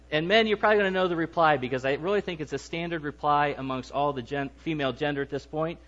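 normal talking voice

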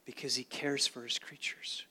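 soft unvoiced whisper. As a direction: breathe it like a secret. Tender point about God's care.

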